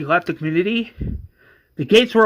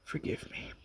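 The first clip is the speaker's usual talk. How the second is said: whispered apology; 19 dB quieter than usual. Acted. Johnny apologizing after yelling.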